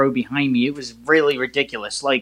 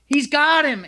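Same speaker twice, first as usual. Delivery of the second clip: high excited shout of the play; retold yell; 11 semitones higher, slower than usual. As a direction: yell it like the touchdown just happened. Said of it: "I pretty much yelled".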